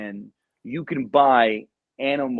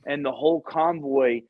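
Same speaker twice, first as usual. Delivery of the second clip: plain reading voice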